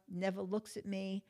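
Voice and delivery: ordinary talk, nothing marked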